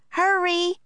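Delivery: high urgent shout. A narrator's reading. Annie urges Lucy to hurry back, a high urgent shout. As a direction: hurry them along, urgently. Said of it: "shouted Annie".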